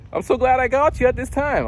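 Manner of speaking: high-pitched robocall greeting; automated caller. Retold or imitated. Automated caller greeting him, high chirpy imitation. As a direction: mimic a perky recorded salesperson. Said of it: "I'm talking to this robot".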